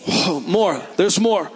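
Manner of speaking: ordinary talk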